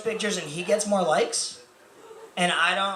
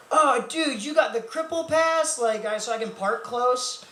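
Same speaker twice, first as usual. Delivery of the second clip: mock excited bro; 7 semitones higher than usual. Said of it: "people be like".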